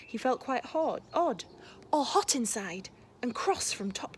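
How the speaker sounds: lively, expressive picture-book read-aloud; reading to children. A narrator's reading. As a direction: read it like a bedtime story for a class.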